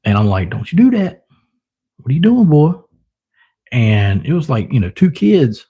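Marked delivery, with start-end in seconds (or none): 0.5-2.7 higher-pitched telling-off of a pet; mock scolding. Owner retelling warning his pet off the other, scolding. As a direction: scold the animal, voice jumping up.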